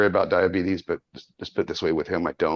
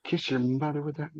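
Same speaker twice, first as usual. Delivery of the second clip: high, prissy pretend reprimand, softer; mock prim scolding; 7 semitones higher, 5 dB quieter than usual. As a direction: play the shocked old aunt. Mock scolding a swearing friend.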